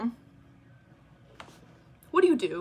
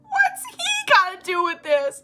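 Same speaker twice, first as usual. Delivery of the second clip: mocking mom's list; 9 semitones higher, 5 dB louder than usual. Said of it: "She's like".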